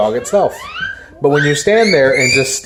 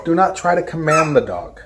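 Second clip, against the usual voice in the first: ordinary delivery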